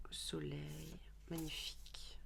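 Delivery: soft admiring whisper; chuchoté. Real, not acted. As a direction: whisper it in awe. Admiring a card softly. (murmuré avec admiration)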